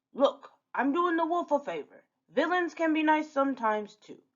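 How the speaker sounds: high-pitched, put-on pirate villain; pirate Captain Hook. Hook defending his favor to the wolf, protesting.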